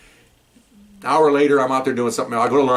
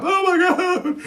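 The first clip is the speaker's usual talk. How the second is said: much higher, wailing mock despair; mock panic; much slower than usual. Arrested man moans about his dog, high mock wail. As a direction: cry out like a frightened man.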